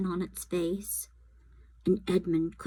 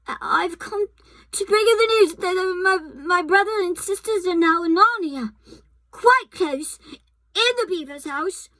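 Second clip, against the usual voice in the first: much higher, hurried and stumbling; frightened Edmund reporting to the wolf; nervous boy; 11 semitones higher, 3 dB louder than usual. A narrator's reading. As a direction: gabble nervously with stammers. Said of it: "trembling so that he could hardly speak".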